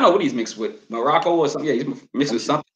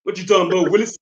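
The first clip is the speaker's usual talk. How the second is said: catchphrase impression; 3 semitones higher than usual. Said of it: "You can say that to Martin Luther King".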